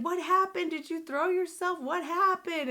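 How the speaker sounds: high-pitched imitated panicked questions; retold yelling. Grandma questioning her fallen granddaughter, high retold yelling. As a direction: re-enact an alarmed grandma yelling. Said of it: "my grandma's yelling".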